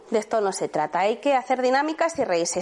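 normal talking voice

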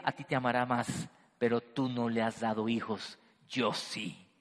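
low, quiet mocking whisper-like taunt; taunting rival wife. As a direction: sneer it at her rival, low and soft. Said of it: "lo que Penina le diría? Le diría".

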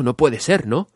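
ordinary delivery, nothing marked

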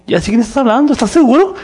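can't believe who he's sent to; incredulous. Retold or imitated. Ananías asking the Lord if he's sure, loud and high.

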